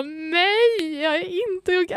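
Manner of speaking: loud, high mock-crying wail; tearful protest. Answering worried sister in retold breakdown, tearful wail. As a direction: wail it back, hammed-up and tearful.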